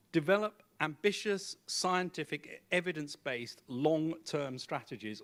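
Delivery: deliberate list of stressed words; each word weighted. Real, not acted. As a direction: give every word its own weight. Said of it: "all of those words are important".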